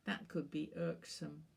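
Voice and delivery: ordinary delivery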